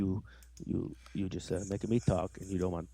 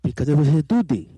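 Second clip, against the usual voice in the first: higher, louder comic character punchline; different, funny voice; 4 semitones higher, 8 dB louder than usual. Shown as a demonstration. Proving voices are funnier, loud silly character voice. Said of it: "read it in a different voice".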